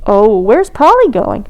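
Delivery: plain reading voice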